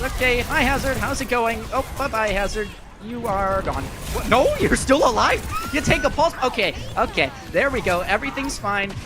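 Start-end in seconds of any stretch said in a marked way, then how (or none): none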